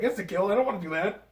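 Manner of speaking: high, panicky mock protest; Spider-Man quote. Quoting Spider-Man refusing instant kill, high mock protest.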